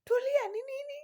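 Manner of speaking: worried mother asking what happened; worried mom. Retold or imitated. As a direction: plead like a frantic mother. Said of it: "My mom is like".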